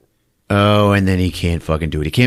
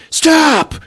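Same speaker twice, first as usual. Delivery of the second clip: high, loud commanding yell; 5 dB louder than usual. Retold or imitated. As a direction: bark it to freeze her.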